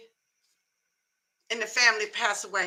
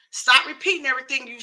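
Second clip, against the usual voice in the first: ordinary delivery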